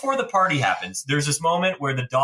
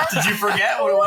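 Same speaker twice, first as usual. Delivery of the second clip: laughing. Real, not acted. Teasing a friend who forgot the plot, laughing high. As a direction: laugh through the teasing question.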